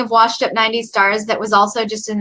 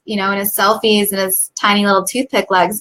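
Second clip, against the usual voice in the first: ordinary talk, nothing marked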